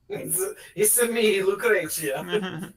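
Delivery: slow, drawn-out grand self-introduction; character intro. Acted. Player revealing her character, slow grand intro. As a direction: unveil yourself slowly and grandly. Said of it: "That's me".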